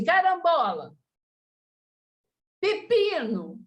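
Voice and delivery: fruit names blurted high and slow like swear words; a silly substitute curse instead of a swear word; mock curse with fruit names. Shown as a demonstration. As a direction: swap the curse for pepino, drawn out. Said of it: "você fala assim".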